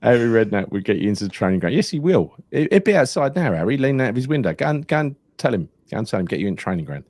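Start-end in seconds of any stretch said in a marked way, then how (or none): 1.7-7.0 lively, higher, fast excited riff. Pretend. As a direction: rattle it off, carried away. Excited riff about Harry Redknapp.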